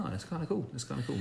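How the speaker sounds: soft, hushed "that's kinda cool"; quiet musing. Retold or imitated. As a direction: murmur it to yourself. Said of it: "Because I'm like".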